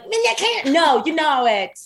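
high, whiny comeback; mock protest. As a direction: protest like a brat. Retorting to boyfriend in a story.

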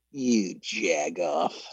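low, drawn-out contempt; imagined contemptuous thought. Retold or imitated. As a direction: voice a scornful inner thought, very slowly. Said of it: "almost hear them thinking".

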